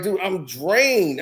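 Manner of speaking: worn-out drawl. Retold or imitated. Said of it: "He's like".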